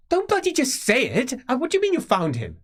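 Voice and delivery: high, loud, fast angry shouting; exasperated nephew yelling; furious outburst. Acted. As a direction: explode at her in disbelief. Nephew erupts at his aunt.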